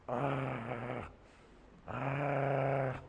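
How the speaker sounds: a low guttural growl like a guarding dog; imitated dog growl. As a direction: growl like a loyal dog protecting its owner. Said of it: "learn how to become like a dog".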